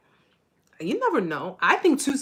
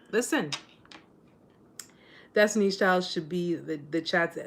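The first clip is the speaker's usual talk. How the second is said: ordinary talk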